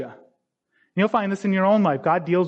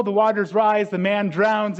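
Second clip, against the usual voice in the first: ordinary talk, nothing marked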